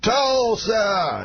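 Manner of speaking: high crowd-rousing call, stretched a little; Bill Cosby. Retold or imitated. Headliner works up the stadium crowd, high imitated call. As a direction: imitate him calling out TULSA up high. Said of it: "every time slower".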